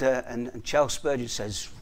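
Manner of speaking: normal talking voice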